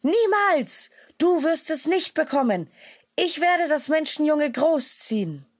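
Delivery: she-wolf defends the man-cub, loud and fierce; furious she-wolf. A narrator's reading. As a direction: hurl it at the tiger to drive him off. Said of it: "Wütend stürzte sich die Wölfin".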